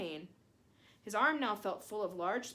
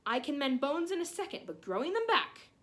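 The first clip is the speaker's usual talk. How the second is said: angry scolding; 6 semitones higher, 6 dB louder than usual. A narrator's reading. Pomfrey scolding Harry.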